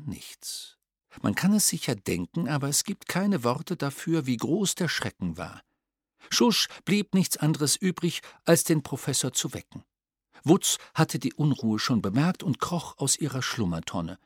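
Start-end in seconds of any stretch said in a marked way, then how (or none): none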